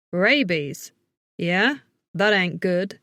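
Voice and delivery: gruff southern twang; Mason. A narrator's reading. Man hearing of rabies, gruff southern twang. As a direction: react in a gruff southern drawl. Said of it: "with a bit of a southern twang".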